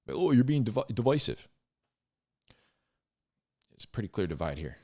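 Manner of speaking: ordinary talk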